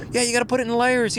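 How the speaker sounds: high, loud, quick mock daughter voice; imitating daughter. Dad mimics daughter advising on layers, high quick caricature. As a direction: rattle it off as a high-pitched caricature. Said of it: "My kid don't talk like that".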